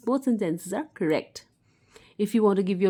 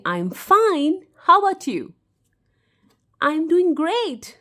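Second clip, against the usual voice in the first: cheerful reply; 7 semitones higher, 5 dB louder, slower than usual. Shown as a demonstration. Teacher demos cheerful replies.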